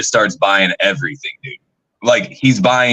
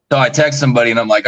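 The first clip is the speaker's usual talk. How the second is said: ordinary talk, nothing marked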